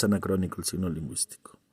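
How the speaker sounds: soft unvoiced whisper of the same line; hushed classroom aside. Shown as a demonstration. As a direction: drop to a whisper for the repeat. Teacher repeats line as aside.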